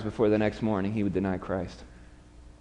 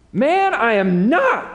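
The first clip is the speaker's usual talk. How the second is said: slow, high, louder denial; Peter's denial; 10 semitones higher, 5 dB louder, slower than usual. Acted. Peter answering the bystander by the fire, slow indignant denial. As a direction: draw out the protest, higher and louder. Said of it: "Peter replied".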